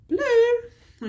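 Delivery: high, loud, drawn-out BLUE; playful exclamation. Real, not acted. Explaining the blue goo nickname to viewers, playful high exclamation.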